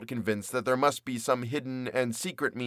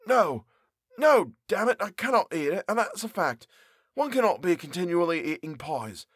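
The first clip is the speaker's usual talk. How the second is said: higher, exasperated character voice; fed-up baker; 6 semitones higher than usual. Acted. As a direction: push the pie away in disgust. Character refuses to eat the pie.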